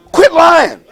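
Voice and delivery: high, loud call-out; sharp high rebuke; calling them out. Real, not acted. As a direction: bust them for it, loudly. Preacher calls out timid answer.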